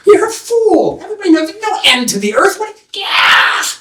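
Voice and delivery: high-pitched jeering townsfolk; scornful mockery. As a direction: jeer at the foolish dreamer, way up high. People mock the seeker.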